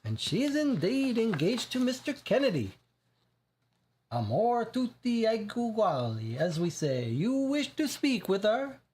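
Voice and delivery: put-on high, effusive Italian-accented gentleman; strong Italian accent, gracious host. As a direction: keep the thick Italian accent going. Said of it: "a strong Italian accent".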